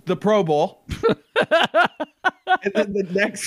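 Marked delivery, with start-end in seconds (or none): 0.9-2.6 a sudden high burst of laughter. Real, not acted. Laughing at Pro Bowl recap.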